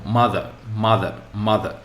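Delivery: "mother" with the first syllable punched, the second falling away; stress on first syllable. Shown as a demonstration. Teacher showing learners where a noun is stressed, first syllable of "mother" stressed. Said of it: "focus on the first syllable".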